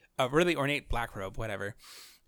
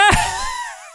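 high shrieking laugh; 5 dB louder than usual. Real, not acted. Laughing at own squeaky voice.

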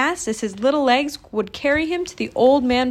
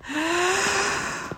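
deep breath in; 9 semitones higher, 4 dB quieter than usual. A narrator's reading. Chang readies to repeat the message, deep gasp. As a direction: gulp in a big breath. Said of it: "took a deep breath".

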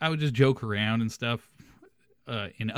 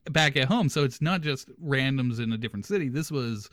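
ordinary talk

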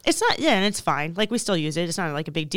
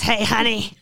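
put-on old diner waitress; 2 semitones higher, 4 dB louder than usual. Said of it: "Yeah, she says".

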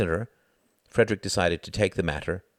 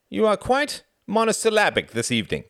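high, loud teasing through a grin; grinning tease; 4 dB louder than usual. A narrator's reading. Frederick teases his terse friend, grinning. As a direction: needle him playfully, voice raised. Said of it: "grinned Frederick".

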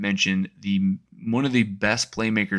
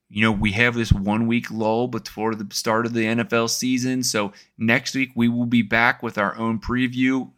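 normal talking voice

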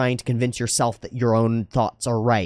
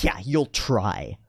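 slow, drawn-out mock agreement; sarcastic disbelief, words stretched out; scoffing, dismissive; 3 semitones lower, much slower than usual. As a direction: imitate someone sneering at a promise. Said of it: "it got scoffed at and dismissed".

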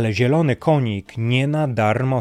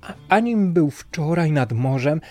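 much higher, earnest protesting voice; rider's high voice; 8 semitones higher than usual. A narrator's reading. Falsely accused rider swears innocence to the king, high earnest voice. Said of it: "odparł jeździec".

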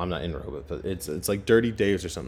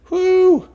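high, loud party whoop; whoo cheer; 10 dB louder than usual. Real, not acted. Picturing an all-night sleepover with a loud whoop. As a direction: let out a wild party whoo.

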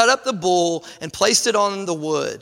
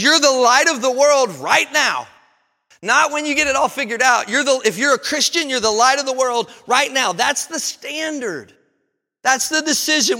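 raised, fervent pulpit voice urging the crowd; preaching at full force; 4 semitones higher than usual. Acted. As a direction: preach it like a challenge to the church.

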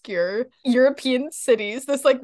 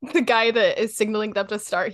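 ordinary talk, nothing marked

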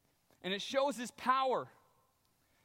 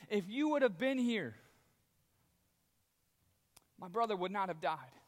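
accusatory. Retold or imitated. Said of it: "that same accusatory type thing".

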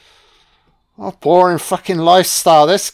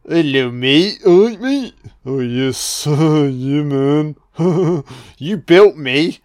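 snowman voice; 2 semitones lower, slower than usual. Pretend. Voicing the snowman coming alive.

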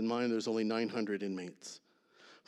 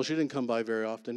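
ordinary talk

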